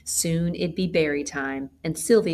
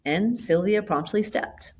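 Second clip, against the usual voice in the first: usual speaking voice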